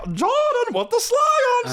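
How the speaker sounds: slow, very high catchphrase wail; mock exclamation. Saying no story qualifies, slow high catchphrase wail. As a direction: stretch it out in a high squeal.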